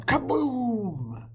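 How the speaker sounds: big booming explosion sound; explosive kaboom. Acted. Narrator booming the potion's explosion, loud and high.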